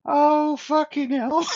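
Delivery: very high, loud exclamation breaking into laughter; laughing outcry. Real, not acted. Host reacts to guest going straight for the jugular, laughing outcry. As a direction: squeal it out as you crack up.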